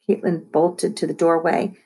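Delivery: ordinary delivery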